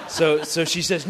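usual speaking voice

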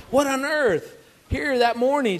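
high, loud disbelief; incredulous. Real, not acted. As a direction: exclaim it in total disbelief. Dad baffled by daughter's change.

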